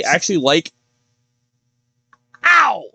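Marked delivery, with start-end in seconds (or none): 2.4-2.9 sharp high yelp of pain; real sudden ow of pain; stubbed toe. Real, not acted. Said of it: "Sorry, I just stubbed my toe".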